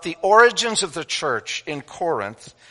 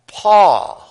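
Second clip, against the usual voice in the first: PAUL called out loud and high, then a pause; emphatic reading pause; 6 semitones higher, 10 dB louder than usual. Shown as a demonstration. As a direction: launch the reading with a big, loud PAUL and stop at the comma. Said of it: "pay attention to the punctuation".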